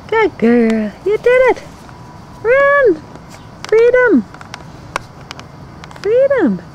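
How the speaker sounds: high, bright celebrating; cheering. Real, not acted. Owner celebrating puppy's escape. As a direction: cheer her on as she bolts.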